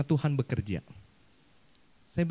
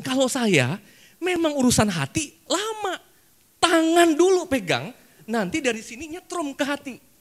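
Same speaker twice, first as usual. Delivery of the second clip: mock pleading; 12 semitones higher, 7 dB louder than usual. Pastor teasing his wife to hold hands, high playful coax. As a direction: stretch it out, teasing and sweet.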